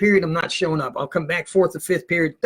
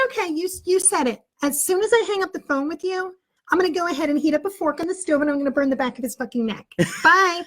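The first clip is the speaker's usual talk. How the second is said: high put-on mother making threats; fake mom voice; 10 semitones higher than usual. Teen as Mom promises the teacher a punishment, high-pitched. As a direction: menace sweetly through a high mom voice. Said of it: "I got the voice down pat".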